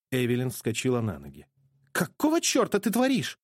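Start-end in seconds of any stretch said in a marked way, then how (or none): 1.9-3.4 shocked. A narrator's reading. Evelyn shocked and alarmed.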